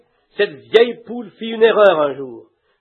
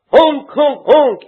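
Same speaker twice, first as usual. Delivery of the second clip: mock duck honk; 5 semitones higher, 6 dB louder, much slower than usual. Duck heading straight for water.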